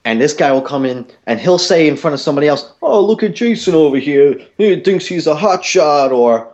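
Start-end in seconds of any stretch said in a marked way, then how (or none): 2.8-6.1 high-pitched mock of a jealous customer; needling the guy in a high mocking voice; mocking jeer. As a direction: jeer for an audience in a raised, put-on voice. Jealous customer jeering before others.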